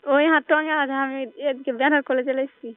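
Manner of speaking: normal talking voice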